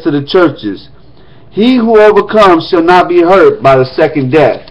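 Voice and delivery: formal reading of Revelation; scripture reading. Acted. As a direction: read the letter aloud to the congregation. Reads letter to Smyrna.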